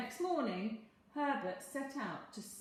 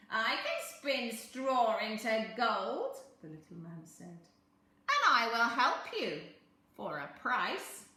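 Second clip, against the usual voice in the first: sly little man; 9 dB louder than usual. A narrator's reading. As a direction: bargain like a crafty imp.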